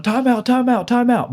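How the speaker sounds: high, urgent mock sideline yell; coach yelling timeout. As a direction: call the timeout like a frantic coach. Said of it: "and he's running down the field going".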